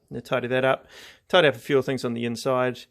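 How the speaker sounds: usual speaking voice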